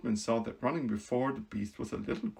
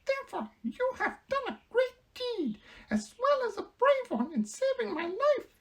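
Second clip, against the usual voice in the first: mouse queen voice. Acted. Queen telling the Woodman who she is, proud squeak. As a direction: announce her rank grandly in a squeak.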